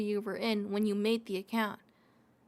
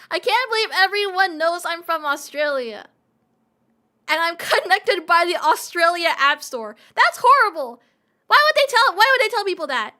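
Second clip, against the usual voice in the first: mock outrage; 5 semitones higher, 10 dB louder, faster than usual. Mock outrage at Twitter update.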